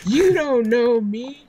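mock dramatic. Mock dramatic denial to friend.

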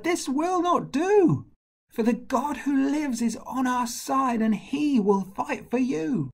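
very high, ringing defiance; impassioned cry. A narrator's reading. Shepherd rallies the army against Goliath, high impassioned cry. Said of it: "And he cried".

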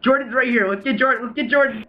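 hyped gamer bro. Real, not acted. Hunting another player.

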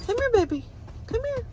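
high coaxing call. Real, not acted. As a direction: coax her over sweetly. Calling the dog.

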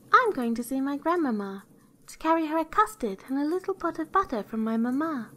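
put-on high, innocent girl's voice; little girl. A narrator's reading. Girl tells the wolf her errand, high and innocent.

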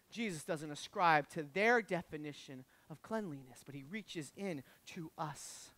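low, soft, slowing sermon voice; hushed preaching. Real, not acted. Preacher assuring the congregation Jesus reaches in, hushed. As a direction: bring it down low and gentle.

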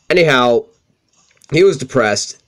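ordinary talk, nothing marked

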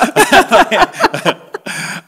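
a loud, high burst of laughter; big laugh. Real, not acted. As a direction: break into a big real laugh. Laughing at his own story.

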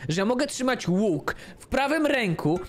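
high-pitched exasperated rant; worked-up, irritated. Real, not acted. Player griping about bow and flashlight, exasperated. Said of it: "Aż się poplułem z nerwów".

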